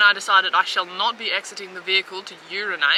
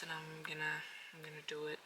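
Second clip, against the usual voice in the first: very quiet; 4 semitones lower, 23 dB quieter, faster than usual. Real, not acted. Quiet resolve, fading.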